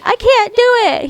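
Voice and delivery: high, whining "I can't do it"; imitating a boy who gives up; whiny kid. Mocking Rowan giving up, in a whiny high voice. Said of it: "he's like".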